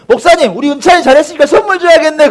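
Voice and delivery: high, loud, eager appeal; acted urging. Elder urges pastor to reward the boy, acted urging. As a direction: press the pastor on a child's behalf.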